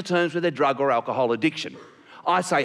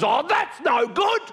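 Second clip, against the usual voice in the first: high, drawn-out indignant cry; slow, high-pitched comic indignation; 10 semitones higher, slower than usual. Acted. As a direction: play an outraged hobo protesting. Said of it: "he stands up and he is indignant".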